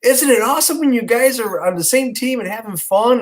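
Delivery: high, enthusiastic coaxing; cheerleading parent voice, pitched high; upbeat parent. Retold or imitated. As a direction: talk up teamwork to little kids. Dad praising children's teamwork.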